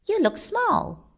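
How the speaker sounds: very high little voice; squeaky character. A narrator's reading. Onlooker talking to the thin rabbit, high squeak. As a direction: pipe it up high and small.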